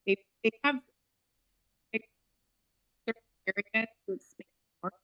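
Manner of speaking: choppy dropping call audio; cutting in and out on a bad connection; breaking up. Real, not acted. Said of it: "You're breaking up a bit".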